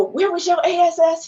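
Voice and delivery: high-pitched imitation of a man yelling; retold yelling. Partner demanding where she was in the theater, imitated yelling. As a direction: act out his yell without full volume. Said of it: "he's just, he's yelling".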